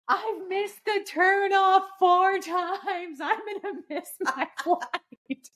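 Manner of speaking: high, slow panicked wail. Retold or imitated. Panicked call to husband, lost.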